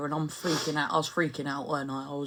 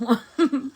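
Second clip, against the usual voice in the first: short laugh; 8 semitones higher, 6 dB louder than usual. Real, not acted. Laughs after story.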